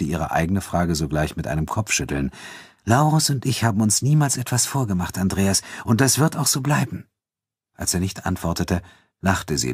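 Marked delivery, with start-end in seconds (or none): 2.8-7.1 a hint of a self-assured woman, higher pitched; lightly acted higher female character; lightly voiced Elena, self-assured.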